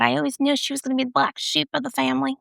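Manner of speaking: relatives voiced in a mock Southern accent. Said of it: "saying with a Southern accent".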